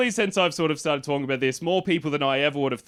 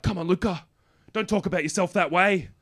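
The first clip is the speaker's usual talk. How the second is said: put-on earnest American accent, a little raised; American therapist accent; 4 semitones higher than usual. Therapist reassuring his client, imitated American accent. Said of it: "He's an American man".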